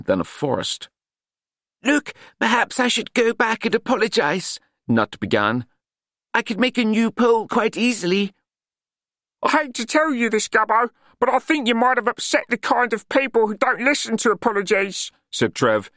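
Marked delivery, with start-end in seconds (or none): 1.8-8.3 put-on a higher, earnest and courteous young man; sincerely offering to fix things; polite Nutt voice. A narrator's reading. 9.4-15.1 a higher, cheeky city lad; warning a friend, half amused; street lad Trev. A narrator's reading. Trev voiced in story.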